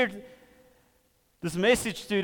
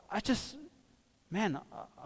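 ordinary talk, nothing marked

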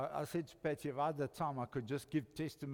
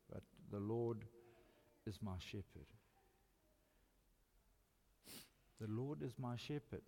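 quiet and low, "The Lord is my shepherd"; whisper-close devotion; soft reverent repeat; 5 semitones lower, 8 dB quieter than usual. Real, not acted. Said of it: "repeated softly".

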